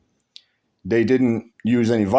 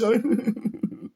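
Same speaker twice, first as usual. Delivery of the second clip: laugh; 11 semitones higher, 5 dB quieter than usual. Real, not acted. Laughs after mock.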